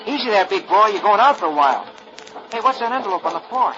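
put-on a kid gentling a horse; Rusty the boy.

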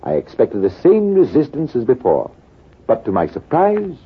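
ordinary talk, nothing marked

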